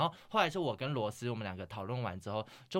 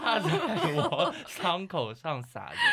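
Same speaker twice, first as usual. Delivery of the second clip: high, loud, very slow words through laughter; laughing complaint; 9 semitones higher, 7 dB louder, much slower than usual. Real, not acted. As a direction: drag it out while still laughing. Laughing complaint about the boyfriend. (边笑边拖长音抱怨)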